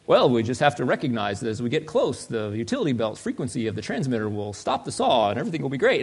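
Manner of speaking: low, unflappable superhero explanation; Batman. Pretend. Superhero explains the escape to his sidekick, coolly. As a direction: stay absurdly cool, mock-heroic and low. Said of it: "But Batman is always cool".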